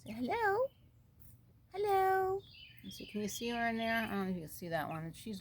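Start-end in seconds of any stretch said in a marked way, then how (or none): 0.0-2.4 very high, soft singsong; tiny high coo; coaxing pet talk. Real, not acted. Keeper prompting birds to say hello, softly cooed.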